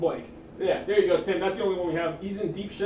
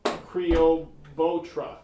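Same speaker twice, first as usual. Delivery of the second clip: slow, syllable by syllable; sounding out syllables; 3 semitones higher, 6 dB louder, much slower than usual. Shown as a demonstration. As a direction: sound it out like a second grader. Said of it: "sound this out syllable by syllable".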